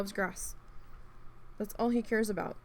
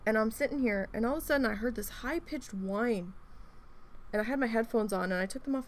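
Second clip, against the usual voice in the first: ordinary talk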